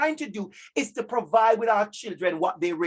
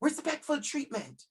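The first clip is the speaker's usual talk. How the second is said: "Respectful treatment" repeated softer, falling gently; soft echo; 4 semitones higher, 6 dB quieter than usual. Shown as a demonstration. Teacher echoes the key phrase to parents, softly. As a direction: let "Respectful treatment" sink in quietly. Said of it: "It's simple that".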